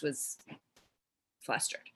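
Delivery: breathy whisper, trailing right off. Real, not acted. Recalling being flustered with a client, whispered. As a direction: let the word fall away to a whisper. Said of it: "I'm flustered, totally".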